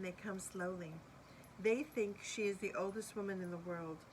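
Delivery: ordinary delivery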